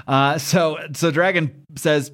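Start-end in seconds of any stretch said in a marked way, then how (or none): none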